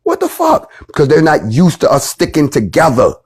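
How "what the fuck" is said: shocked squeak, pitched way up; a baffled officer's high disbelief; stunned cop. Retold or imitated. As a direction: cop caught off guard, yelping in surprise. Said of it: "the look on this officer's face like".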